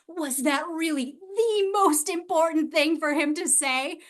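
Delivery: ordinary talk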